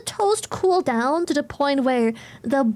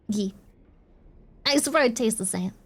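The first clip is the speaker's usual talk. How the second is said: ordinary talk, nothing marked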